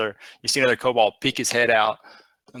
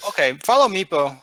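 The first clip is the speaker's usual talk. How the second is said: kobold guide; 7 semitones higher, 4 dB louder than usual. Acted. Meepo leads the party.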